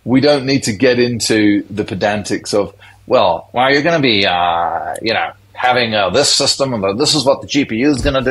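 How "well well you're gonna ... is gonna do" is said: mock American accent, scientific tone. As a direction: put on an American accent, techy and brisk. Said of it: "That's my American accent".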